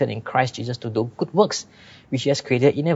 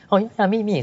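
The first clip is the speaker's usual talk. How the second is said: eager excited reply; 9 semitones higher, faster than usual. Retold or imitated. Stranger eagerly claims lost lens.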